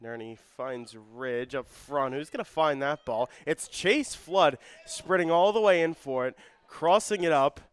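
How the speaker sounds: excited soccer commentary peaking on the save; live play-by-play. Real, not acted. Live call building to a save.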